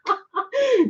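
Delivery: high giddy giggle; giddy laugh. Real, not acted. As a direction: burst into a delighted laugh. Excited about tarot gift.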